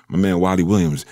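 ordinary delivery

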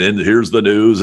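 puffed-up announcer voice, parodied; mock radio announcer. Young DJ mocking radio voice of the era: hammed-up announcer. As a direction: send up the old-school news voice. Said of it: "it was the puking voice".

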